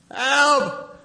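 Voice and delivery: a terrified yelp of "Help."; panicked cry for help. Retold or imitated. Moses crying out to the Lord in high panic. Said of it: "that kind of prayer".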